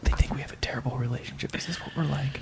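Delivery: hushed whisper. Acted. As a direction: whisper it so nobody hears. Whispering about Christians nearby.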